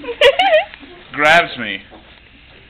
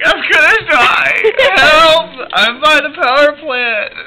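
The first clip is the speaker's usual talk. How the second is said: high, slow, wailing call for help; mock crying plea; 11 semitones higher, 4 dB louder, slower than usual. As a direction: beg for help, hammed-up sobbing. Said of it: "Act out your wreck".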